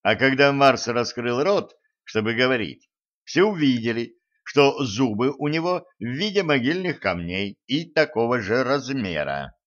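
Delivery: ordinary delivery, nothing marked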